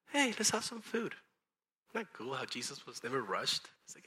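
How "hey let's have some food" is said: high, easygoing mock invitation; casual invitation. Jesus answering a baffled Peter, casual invitation. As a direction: brush it off and offer food.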